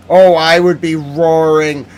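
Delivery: loud, slow declaration of anger; roaring mad. Real, not acted. Telling viewers how furious they'd be, loud and slow. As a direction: let the fury build on roaring. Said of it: "you've never seen me roar".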